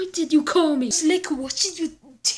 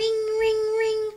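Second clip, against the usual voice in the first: high mock phone ring; 7 semitones higher than usual. Imitated phone ringing.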